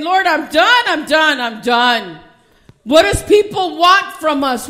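loud, high preaching that builds to shouted cries; full-force preaching. Acted. Pastor pouring out her heart to the congregation, loud preaching. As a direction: preach it out to the rafters, crying out to God.

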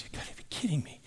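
whispered, acted-out disbelief; devastated. Minister devastated by the Lord's answer, whispered disbelief. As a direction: imitate a crushed man, barely any voice. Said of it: "oh, he was devastated".

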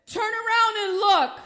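loud, high-pitched divine command; retold command at full preaching force; commanding Spirit of God. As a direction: call it out like an urgent order from heaven. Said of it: "the Lord's Spirit said".

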